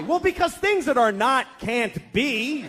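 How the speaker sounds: slow, high, insistent reasoning; strained explanation. Acted. Father answering yet another why, strained insistence. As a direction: force out each abstract word.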